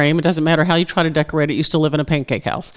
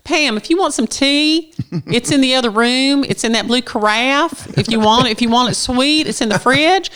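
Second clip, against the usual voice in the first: pitched-up Southern lilt, each phrase ending as a question; young Southern girl; 8 semitones higher than usual. Acted. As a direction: lift every phrase end like a Southern teen. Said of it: "their voices go up at the end".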